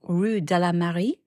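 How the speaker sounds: slow, careful French street name; earnest tourist French; French accent. Retold or imitated. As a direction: try your best French accent. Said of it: "perfectly French accent".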